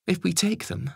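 high, insistent Home Secretary. Acted. Home Secretary warning the Prime Minister, high and insistent.

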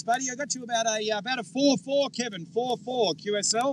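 usual speaking voice

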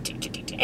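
very soft, voiceless clicking ticks; imitated ticking. Vlogger imitating the device shocking face muscles, soft ticking. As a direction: mimic tiny electric pulses quietly. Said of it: "so it's like".

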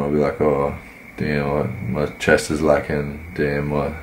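normal talking voice

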